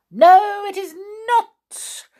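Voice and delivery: very high, loud creature shriek; shouting. A narrator's reading. Creature rejecting the first name, shouting. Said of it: "shouted the little creature".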